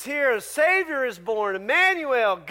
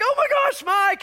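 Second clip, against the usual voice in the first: much higher, louder gasp of recognition; surprised delight; 4 dB louder than usual. Retold or imitated. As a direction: burst out happily at an old friend. Friend recognizing him, retold.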